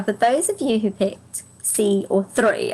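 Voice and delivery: usual speaking voice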